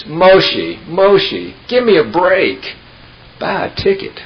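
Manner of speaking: high, loud exasperated call of Moshe; exasperated God voice. Retold or imitated. God from the eaves tells the praying man to buy a ticket, exasperated and loud. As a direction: sigh it out like God losing patience. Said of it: "big, deep voice says".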